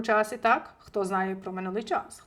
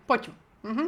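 ordinary talk

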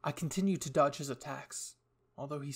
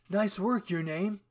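loud, higher congratulating voice; upbeat praise, pitched up; 6 semitones higher, 7 dB louder, slower than usual. A narrator's reading. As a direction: cheer a friend on, raised pitch. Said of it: "Aaron congratulated me".

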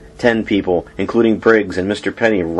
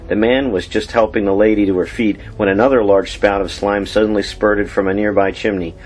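plain reading voice

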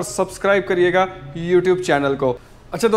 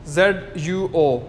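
slow spelling; much slower than usual. Shown as a demonstration. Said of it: "spelling letters slowly".

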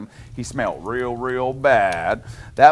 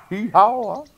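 donkey bray; 9 semitones higher, 4 dB louder than usual. Acted. Reader voices the donkey's bray.

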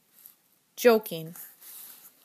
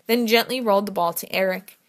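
ordinary delivery, nothing marked

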